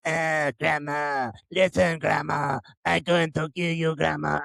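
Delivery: slow, creepy possessed girl in a put-on Middle Eastern accent; mock Middle Eastern accent. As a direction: threaten Grandma slowly in a hammed-up foreign accent. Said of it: "You sound like a fucking Mexican pirate".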